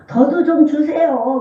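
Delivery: slow pleading whine; begging demo. Shown as a demonstration. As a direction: whine it like begging for money owed. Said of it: "빚쟁이한테 돈 달라는 것처럼".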